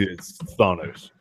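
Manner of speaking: "Thanos" said slow and soft with a broad AH; slow "Thanos" with the open A of "father"; mock pronunciation. Shown as a demonstration. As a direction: savor THAH-nohs, slowly. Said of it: "that's how he wants to pronounce it".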